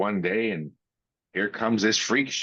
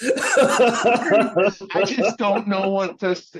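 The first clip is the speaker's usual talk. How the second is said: laughing; 10 semitones higher, 5 dB louder, much slower than usual. Real, not acted. Host laughing at his own story.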